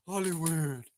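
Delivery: loud Hollywood dropping low and falling. Pretend. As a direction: land Hollywood heavy and low. Chanting Hollywood, final call.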